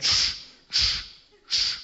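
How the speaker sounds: voiceless chalk squeaks; chalk squeak noise. Retold or imitated. Boy drawing a triangle on the board, squeaking chalk. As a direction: shush out the chalk strokes without voice. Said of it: "and he went".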